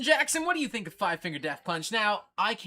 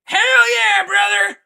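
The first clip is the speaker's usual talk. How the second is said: screaming metalhead, far higher and louder, slow on the big words; Thrashin' Jackson impersonation; 21 dB louder, much slower than usual. Retold or imitated. Streamer impersonates metal fan Thrashin' Jackson for chat, screaming and hammed-up. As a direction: rant like a hammed-up thrash fanatic. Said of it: "this is what he would say".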